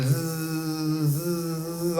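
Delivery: soft buzzing zzz like a mosquito; mosquito buzz imitation. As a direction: buzz quietly like an insect near your ear.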